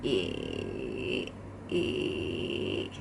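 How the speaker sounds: deep creaky rattling hum; vocal fry. Shown as a demonstration. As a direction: let the voice crackle lazily on a low hum. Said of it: "Or you could do it as an E vowel".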